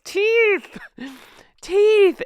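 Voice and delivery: shrill high shouted word, then a laugh; screaming teeth. Pretend. Recapper shouting a running joke at the microphone, high mock yell. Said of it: "I'm screaming teeth into the microphone".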